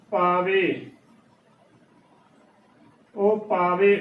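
ਪਾਵੇ as "paa-ve"; wrong two-syllable reading. Shown as a demonstration. Teacher showing the congregation's slip, ਪਾਵੇ as two-syllable "paa-ve". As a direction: fold the e straight into the v of ਪਾਵੇ. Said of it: "ਜੋ ਕਿ ਗਲਤ ਉਚਾਰਨ ਹੈ".

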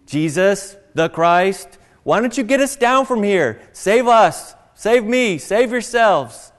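loud mocking yell, drawn out at the end; mocking insults. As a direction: hurl it at him like the scoffing criminal. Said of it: "stuff like".

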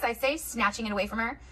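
ordinary delivery, nothing marked

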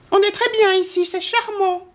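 high-pitched storybook lady; lady in a story. A narrator's reading. Lady at the window admiring the island, high character voice.

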